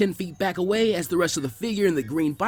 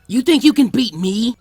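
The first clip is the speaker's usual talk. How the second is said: loud, slow growling taunt; growled challenge; 7 semitones higher, 8 dB louder, slower than usual. Acted. Kiba growling at Naruto, loud and slow. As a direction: growl it at him, spoiling for a fight. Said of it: "Kiba growled at him".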